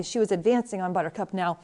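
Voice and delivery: ordinary delivery, nothing marked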